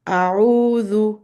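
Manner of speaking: أعوذ with a clear open ayn and voiced dhal; model recitation. Shown as a demonstration. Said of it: "I will start with one word at a time".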